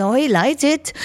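innocent reply. Retold or imitated. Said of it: "I say".

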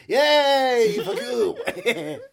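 high, loud drawn-out cheer; mock cheer. Partying next door to piss him off, mock cheer. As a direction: whoop it mockingly.

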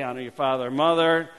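ordinary talk, nothing marked

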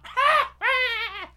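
high helpless cackle; Ricky Gervais laugh. Retold or imitated. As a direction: burst into a shrieking cackle. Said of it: "I sounded like Ricky Gervais".

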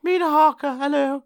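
high put-on lady's voice; Mina Harker voice. Actor warming up as Mina, high put-on voice. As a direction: try out a high-pitched lady character. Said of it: "I'm trying to get the voice right".

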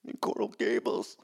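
voiceless, retold whisper of dread; stunned whisper. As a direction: whisper it as if reliving the shock. Retold terrified reaction to threat.

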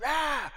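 high mock wail of grief; mock anguished cry. David switching from weeping, mock wail. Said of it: "the next moment he's like".

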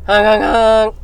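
imitating the Scrubs old man's honk; loud honking imitation. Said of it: "honk honk of the old man from Scrubs".

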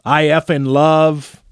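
slow, loud imitated yelling; mock yell. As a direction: drag it out like a shouting player. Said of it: "he's yelling at these reporters".

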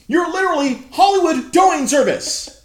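loud, high, outraged retort; fed-up loud shout; exasperated yell. Retold or imitated. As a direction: yell it at the clerk in disbelief. Retold retort to the tow clerk.

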